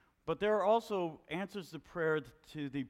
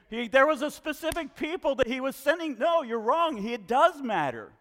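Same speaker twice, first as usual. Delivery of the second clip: louder, higher preaching voice building in force; 9 semitones higher, 10 dB louder than usual. Acted. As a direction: preach it hard to the whole church. Preaching to the congregation.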